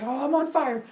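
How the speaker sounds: high-pitched mock glee; excited boast. Epimetheus making his first animal, excited. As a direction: crow it in a high voice.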